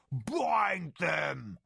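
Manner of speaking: barked order from the tiger leader; commanding order. Acted. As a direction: order them bound with force.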